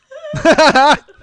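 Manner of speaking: loud high cackle; laugh. Real, not acted. Laughs at own bit.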